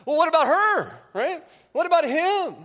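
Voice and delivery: put-on a child dodging chores by blaming a sibling; whiny child. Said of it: "The response is".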